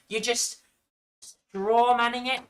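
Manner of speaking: ordinary delivery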